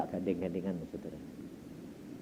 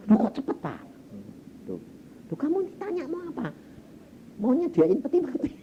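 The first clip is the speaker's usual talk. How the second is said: acted startled retort; 11 semitones higher, faster than usual. Retold surprised reaction and retort.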